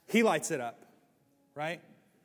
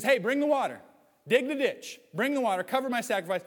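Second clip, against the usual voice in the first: Elijah barking orders, raised and higher; commanding prophet; 6 semitones higher, 3 dB louder than usual. Retold or imitated. Elijah orders the people to pour the water, loud commanding call. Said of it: "And so he says".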